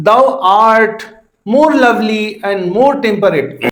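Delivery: slow verse reading with "art" and the "love" of "lovely" leaned on; iambic stress demo. Shown as a demonstration. Teacher reads another line of the poem, slow iambic stress. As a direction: stretch it out, "Thou" leading into a stressed "art". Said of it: "unstressed है followed by art".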